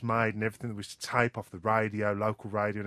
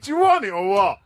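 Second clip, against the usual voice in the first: louder, pushy and impatient; exasperated; 6 semitones higher, 8 dB louder than usual. Retold or imitated. Prankster boy losing patience with the caller, exasperated. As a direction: push him to take the prize. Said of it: "And I went".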